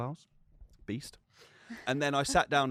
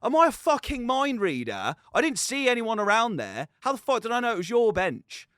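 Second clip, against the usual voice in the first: higher, louder, hammed-up rage; retold angry outburst; 9 semitones higher, 6 dB louder than usual. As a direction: relive the gym row with mock fury. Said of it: "I was going ballistic".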